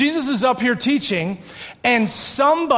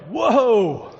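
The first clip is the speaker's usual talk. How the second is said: a mock startled whoa, pitched up; astonished whoa; 3 semitones higher than usual. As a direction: caught off guard, lightly acted. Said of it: "He was probably astonished".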